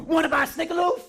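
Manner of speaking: loud, high, laughing yell; shouted. Retold or imitated. Comedian jumping out at a friend in the airport, loud laughing shout. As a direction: jump out and shout it in his face. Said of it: "this is what I shouted at his face".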